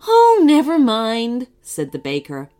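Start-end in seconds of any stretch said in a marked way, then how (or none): 0.1-1.5 slow, loud cheery consolation; reassuring. Acted. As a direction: brush it off kindly. Baker consoles.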